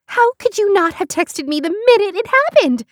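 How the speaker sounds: very high, loud mock outrage; indignant cry. Beth learns she wasn't texted the news, crying out. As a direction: cry out, scandalised and excited. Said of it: "Beth cried".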